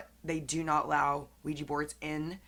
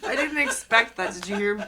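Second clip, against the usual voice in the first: breathless from laughing; out of breath, laughing hard; 4 semitones higher, 8 dB louder than usual. Real, not acted. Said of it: "It's 'cause I can't catch my breath".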